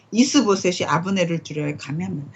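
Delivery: ordinary delivery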